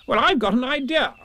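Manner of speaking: high, emphatic Rabbit voice; bossy, confident Rabbit. Acted. Rabbit unveiling his idea to the others, self-important.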